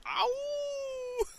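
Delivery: high howling ow; wolf howl. Shown as a demonstration. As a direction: throw your head back and howl. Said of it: "your reply is gonna be like".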